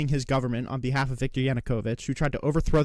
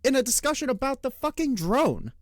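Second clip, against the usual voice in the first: much higher, disbelieving; incredulous outburst; 8 semitones higher than usual. Real, not acted. Streamer calling out Piers Morgan, high incredulous outburst. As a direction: sound floored by the hypocrisy.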